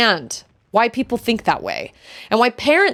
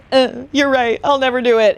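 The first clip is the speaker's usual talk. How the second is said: higher, put-on giving-up voice; mock defeated person; 5 semitones higher, 3 dB louder than usual. Imitating someone told they can't, mock defeated. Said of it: "they will either go".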